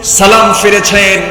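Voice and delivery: high, loud proclaimed narration; full-force preaching. Acted. Preacher narrating the caliph's prayer, full-force preaching. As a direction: call out each phrase high and loud.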